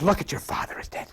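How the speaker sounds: hushed, whispered lion's taunt; the evil lion taunts Simba, hushed and fast. Retold or imitated. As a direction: breathe it like a sly villain.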